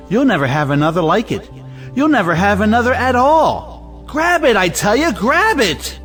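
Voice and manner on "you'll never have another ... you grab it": high-pitched, heated urging; exasperated, insistent command pitched way up; urgent insistence. Acted. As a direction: pressure them hard, voice leaping up. Brother urging sister to accept marriage.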